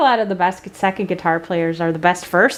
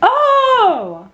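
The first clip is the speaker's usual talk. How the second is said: surprised; 13 semitones higher, 4 dB louder than usual. Real, not acted. Amazed by famous neighbour.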